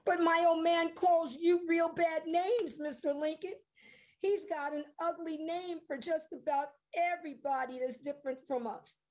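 put-on high young boy's voice, troubled and earnest; a boy admitting his father's ugliness; upset boy. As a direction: confess it like a worried kid. Eugene's voice, child figure.

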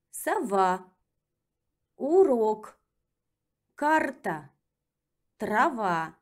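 Сова with full A vowels, slow and clear; careful model reading; slow drill reading. Shown as a demonstration. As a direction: read each word slowly for repetition. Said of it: "let's practice with reading".

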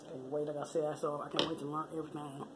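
ordinary talk, nothing marked

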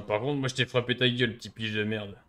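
ordinary talk

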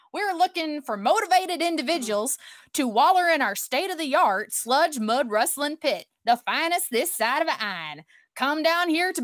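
hammed-up Southern sponsor read; swooping, put-on Oklahoma Southern twang; Southern accent. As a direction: sell it like a Southern fairground announcer. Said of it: "channel my inner South here".

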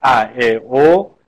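each short vowel sounded alone, clearly; name the short vowels one by one; vowels sounded out for students; short vowels demo. Shown as a demonstration.

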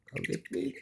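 high, soft chiding; pet-talk voice. Real, not acted. Owner telling pets not to fight, high cooing.

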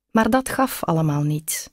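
plain reading voice

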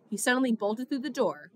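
plain reading voice

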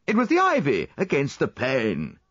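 high exclamation, falling away; cried out. A narrator's reading. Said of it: "I cried".

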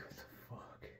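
breathless whisper of disgust; hushed, stunned whisper; whispered what the fuck. Retold or imitated. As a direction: whisper it in horrified disbelief. Stunned reaction, retold, to the dead cat.

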